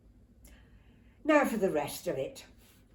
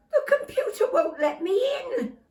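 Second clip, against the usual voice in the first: very high, near-crying voice; tearful distress; 7 dB louder than usual. Acted. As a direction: close to tears. Mum distressed.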